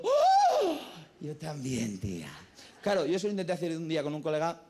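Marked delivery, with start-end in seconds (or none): 0.0-0.8 loud, very high drawn-out gasp; exaggerated amazed gasp. Woman thrilled by her friend's trousers, loud high gasp. Second amazed reaction, acted. 1.2-2.3 low, drawn-out mock amazement; awed mock girlfriend. Woman agreeing with her friend, low awed mock voice.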